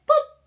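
high, loud little boop; a squeaky loud boop as one disappears; boop sound. Acted. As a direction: pop out a cartoon boop. Sound for taking one away.

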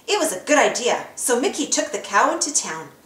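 ordinary delivery

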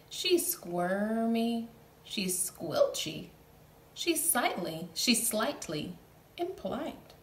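slow, stretched description; slow playful narration; drawn out. A narrator's reading. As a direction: savor the squiggly words.

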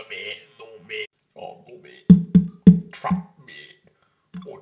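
usual speaking voice

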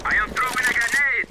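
grenade warning yell. Acted. Callout during round, far voice.

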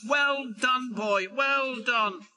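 high, drawn-out yell of praise; yelled cheer. A narrator's reading. Duck praising Oliver's great heave, high yelling. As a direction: cheer him on, high and slowing. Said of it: "yelled Duck".

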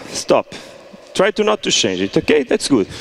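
ordinary talk

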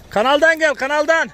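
high urgent call to the driver. Real, not acted. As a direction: shout directions across the mud. Calling directions to driver.

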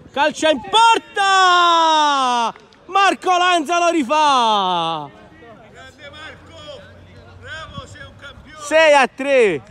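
high stretched goal shout; breathless italian goal commentary. Real, not acted. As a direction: yell the goal, drawing it out.

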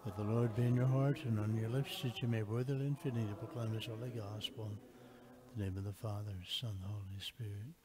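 murmured private prayer, softening; quiet prayer. Real, not acted. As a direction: pray it under the breath.

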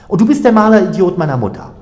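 high, loud, fast comeback; gleeful retort. A narrator's reading. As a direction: fire the insult back at full pitch. Retort before both burst out laughing.

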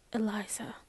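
a hushed whisper of the name; soft whispered Eliza; very softly. A narrator's reading. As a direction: breathe the name out, barely voiced. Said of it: "she said very softly".

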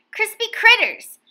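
high, loud delighted cry; crispy critters, delighted; excited exclaim. A narrator's reading. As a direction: burst out with glee. Excited over treats.

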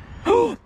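high gasp of surprise; sudden astonished intake; startled gasp. Real, not acted. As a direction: gasp sharply in amazement. Phone answers, startled.

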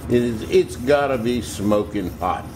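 ordinary talk

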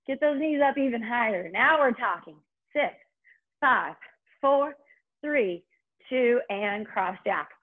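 energetic countdown coaching; aerobics instructor. Acted. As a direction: push them through the last reps.

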